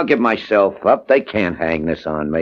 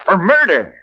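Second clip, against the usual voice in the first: outraged; 11 semitones higher, 3 dB louder than usual. Acted. Client outraged at newspaper.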